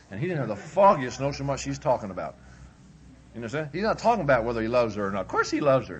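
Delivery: normal talking voice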